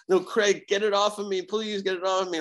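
high, frantic begging; panicked plea. Retold or imitated. Possessed guy begging Craig to remove demon, high frantic plea. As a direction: plead to get the thing off you, pitched high. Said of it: "he would go back and forth".

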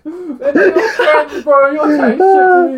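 slow, laughing, cry-like protest. Real, not acted. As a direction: laugh through the words, high. Laughing at friend's answer.

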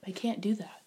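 soft, human-sounding computer; quiet, gentle refusal; Hal. Acted. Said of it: "like, a woman's voice".

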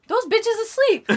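high, loud exclamation of disbelief; exasperated cry, played for laughs; mock outraged yell. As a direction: blurt it out high and loud, drawn out. Retells crying out at sleeping parents.

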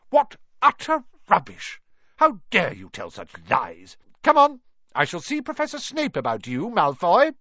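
high stern Scottish teacher; stern scolding. A narrator's reading. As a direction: cut him down sharply. McGonagall scolding.

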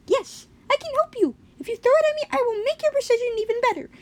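very high squeaky little creature; high-pitched cartoon branch character; talking branch. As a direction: offer help in a tiny squeaky voice.

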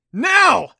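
high, explosive yelled command; yelling. A narrator's reading. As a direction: yell the signal at full force. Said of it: "Adam yelled".

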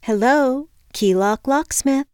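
high, lilting phone greeting; pleasant receptionist. A narrator's reading. Locksmith receptionist answers a call, pleasant. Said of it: "A pleasant-sounding woman answered".

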